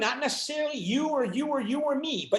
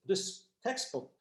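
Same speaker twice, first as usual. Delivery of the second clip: ordinary talk, nothing marked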